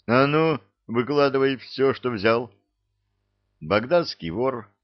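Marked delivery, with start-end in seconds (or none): 0.1-2.5 loud commanding demand; stern demand. Acted. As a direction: order him to hand it over. Colonel demanding loot back.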